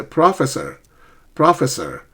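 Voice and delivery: "Professor" stressed on the first syllable; misplaced stress. Shown as a demonstration. Teacher imitating students' stress error, "Professor" with first syllable stressed. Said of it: "my students often make stress errors".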